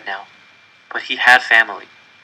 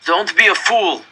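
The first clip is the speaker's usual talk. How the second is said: curt prisoner; 7 semitones higher, 3 dB louder, slower than usual. A narrator's reading. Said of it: "the man said".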